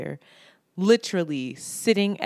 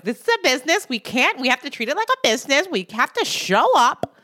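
stern pep talk; 6 semitones higher, 3 dB louder than usual. Retold or imitated. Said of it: "'Cause you know I'm like".

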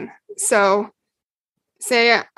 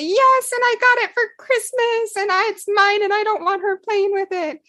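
put-on a small girl telling on her sister; child's complaint; 11 semitones higher than usual. Jen voiced as small child.

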